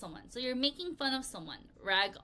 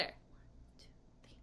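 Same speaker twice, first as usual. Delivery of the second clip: whisper; 18 dB quieter, slower than usual. Real, not acted. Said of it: "whispered count".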